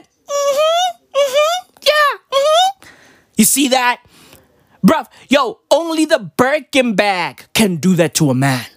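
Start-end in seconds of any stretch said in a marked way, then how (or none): 0.0-2.9 squeaky high hums of agreement; acted high-pitched mm-hmms; high-pitched agreeing. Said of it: "I'd be like".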